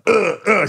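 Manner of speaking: high, loud effort grunts; straining grunts. Retold or imitated. Sister forcing the coffin shut, loud straining grunts. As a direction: grunt like shoving something heavy.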